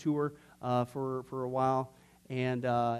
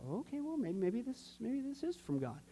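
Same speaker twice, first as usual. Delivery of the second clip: high, soft tentative self-talk; acted hesitant second thoughts; sheepish doubt; 7 semitones higher, 8 dB quieter than usual. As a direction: wonder it aloud, small and high. Voices his own doubting hope.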